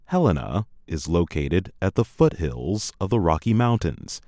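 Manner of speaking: usual speaking voice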